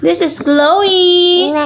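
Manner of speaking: high, slow sing-song introduction. Real, not acted. Introducing baby in baby talk.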